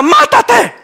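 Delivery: high, hammed-up "Mátate" thrown out for laughs; mock blunt advice. Pastor mocking the congregation's wish, high and cartoonish. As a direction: toss it off absurdly to mock them. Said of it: "quieren que le diga".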